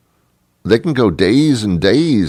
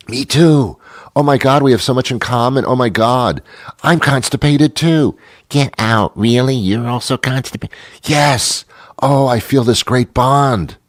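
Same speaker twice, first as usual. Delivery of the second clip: high, gushing, hammed-up excitement; mock thrilled bonding; 6 semitones higher than usual. Dates discover they share a complaint, hammed-up delight. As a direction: gush like you've found a soulmate.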